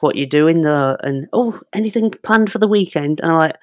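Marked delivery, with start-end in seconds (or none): 1.3-3.2 higher-pitched mock small talk; put-on chummy chattiness; fake matey. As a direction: imitate an over-friendly call handler. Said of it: "when they try and be your mate".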